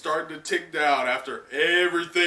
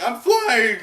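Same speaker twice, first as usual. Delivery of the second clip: much higher, louder joyful cry; excited Momo; 10 semitones higher, 8 dB louder than usual. Acted. Momo finally flying, joyful high cry. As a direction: cry out in amazement. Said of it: "And Momo's like".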